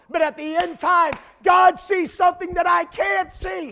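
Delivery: high, loud preacher shout; shouted climax. Acted. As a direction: hit the end time like thunder.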